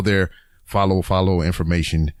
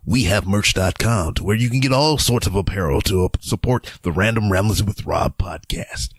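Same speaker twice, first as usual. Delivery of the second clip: announcer voice. Shown as a demonstration. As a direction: read it like a radio ad. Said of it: "That's my announcer voice".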